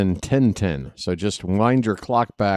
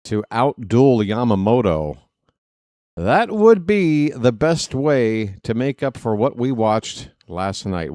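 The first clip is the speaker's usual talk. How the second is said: normal talking voice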